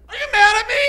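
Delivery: very high, loud comic wail; mock-distressed shriek. Calls out to friend in audience.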